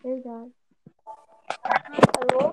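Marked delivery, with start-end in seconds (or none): 1.6-2.5 high, loud calling hello; to someone hard of hearing. Real, not acted. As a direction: call out into the silence.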